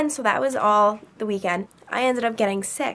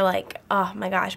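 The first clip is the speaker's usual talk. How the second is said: normal talking voice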